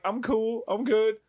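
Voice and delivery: mock composure; feigned calm. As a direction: insist you're cool, a touch too high. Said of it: "And then I'm like".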